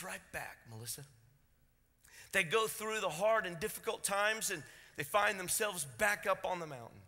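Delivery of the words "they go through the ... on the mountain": louder, higher preaching lift; rising preaching. Acted. Preacher tells the church some bounce back, rising and loud. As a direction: lift their hope with a surge.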